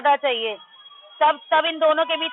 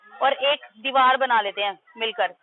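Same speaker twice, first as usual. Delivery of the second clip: normal talking voice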